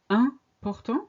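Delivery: important opening on a nasal "un", the French way; French important, no m sounded at the start. Shown as a demonstration. Teacher asking learners why we say it, nasal French opening. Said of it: "why do we say".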